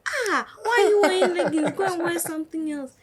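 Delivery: high, loud mock outrage; high-pitched indignant telling-off; shocked scolding. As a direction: exclaim like an appalled adult telling someone off. Acting out someone's scolding over her clothes.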